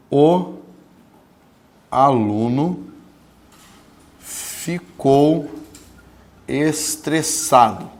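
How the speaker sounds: word by word, slow example dictation. Acted.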